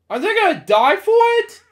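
high, loud, incredulous question; alarmed outrage. Real, not acted. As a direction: cry out in disbelief for their lives. Alarmed reaction to the story.

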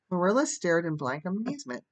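usual speaking voice

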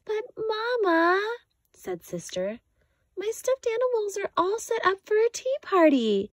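a small cub begging her mother; little girl cub. A narrator's reading. Sister pleads to Mama.

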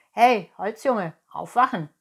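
loud wake-up call through the door. Acted. Innkeeper calls loudly to wake the wooden boy. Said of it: "hörte er es rufen".

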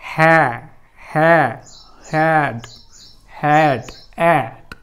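हॅ twice, then हॅड, vowel stretched as in "cat"; sound by sound. Shown as a demonstration. Teacher building the word for students, हॅ to हॅड with long pauses. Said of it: "असं केलेलं आहे मित्रांनो".

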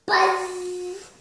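high, loud yell of a name. A narrator's reading. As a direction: yell out to a friend. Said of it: "Fly Guy yelled".

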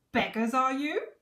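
loud, high, scornful question; shouted. A narrator's reading. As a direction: shout it in royal disdain. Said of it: "shouted the fat king".

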